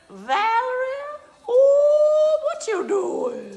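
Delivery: very high, loud, slow Southern drawl; hammed-up Georgia great-aunt, stretching every vowel; old Georgia accent. Imitating great-grandmother from Georgia, old Southern accent. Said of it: "they had these amazing accents. They'd say".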